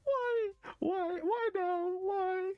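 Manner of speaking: very high, loud squeaking whys; anguished squeal. Real, not acted. Streamer to the game, wailing why in high anguish. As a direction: wail the question way up high.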